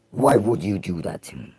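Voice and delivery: low goofy voice; deep silly voice. Shown as a demonstration. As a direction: rumble out "Why would you do that to me?" like a giant. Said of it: "same line four ways".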